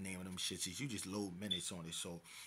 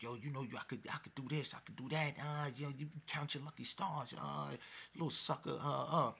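higher-pitched, taunting mock threat; threatening; 5 semitones higher than usual. Storyteller replays threats to man on his knees, threatening. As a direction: act out lording it over him. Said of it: "you know, threatening him".

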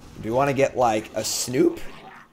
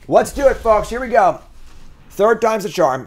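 higher, louder rally; hype; 8 semitones higher, 4 dB louder than usual. Real, not acted. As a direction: pump up the audience. Hyping the next play.

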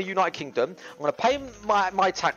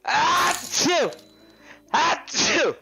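sneezing; 5 semitones higher, much slower than usual. Pretend. I'm allergic.

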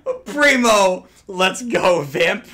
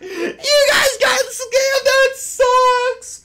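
much higher and louder, slowly, laughing; mocking laugh-shout; 4 dB louder, much slower than usual. Real, not acted. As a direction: cackle at them while you say it. Mocking the Fang players.

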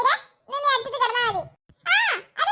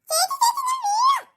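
high, frantic little-brother plea; scared kid begging his sister; panicked pleading; 10 semitones higher than usual. Acted. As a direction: beg her not to drop you. Brother being pushed off bed.

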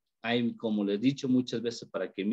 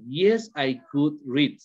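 slow model answers, each falling at the end; short-answer demo; much slower than usual. Shown as a demonstration. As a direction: read out both answers slowly and deliberately. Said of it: "And short answer".

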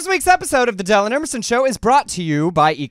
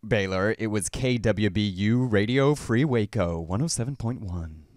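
deep lowered radio voice; bro dude voice; 14 semitones lower, 6 dB quieter than usual. Shown as a demonstration. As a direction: talk low like a late-night DJ. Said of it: "my bro dude voice".

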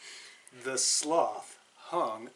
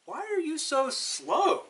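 high squeaky monkey voice, a bit louder; howler monkey; 12 semitones higher, 4 dB louder than usual. Acted. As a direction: pitch it way up like a monkey. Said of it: "the howler monkey asked".

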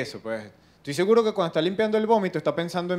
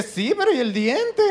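slow, higher, sceptical question with a laugh; mock doubtful crowd; 8 semitones higher, 3 dB louder, slower than usual. A crowd doubting the expert with the missing tooth, slow and mocking. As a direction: ask it like the tooth is all that matters.

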